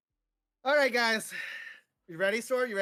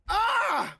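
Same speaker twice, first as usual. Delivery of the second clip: pained scream; 13 semitones higher, 6 dB louder than usual. Real, not acted. Burning wing, sudden pain cry.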